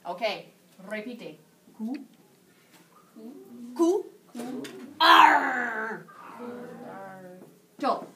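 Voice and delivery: syllable by syllable, with a mild pirate growl on Ar. Shown as a demonstration. Teacher drilling the class in a hammed-up pirate voice. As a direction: break cuarto into syllables like a mock pirate. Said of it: "Why is she talking like a pirate?".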